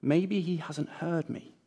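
soft, slow inner thought; quiet thought. Acted. Cyril wondering quietly whether the neighbour heard, softly. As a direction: think it quietly to yourself. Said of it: "thinks Cyril".

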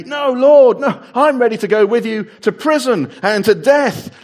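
loud, high and fervent protest; Peter's loud, eager vow of loyalty; bold vow. Acted. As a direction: swear it with all your heart.

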